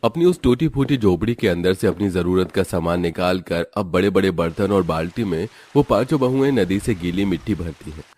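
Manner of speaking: plain reading voice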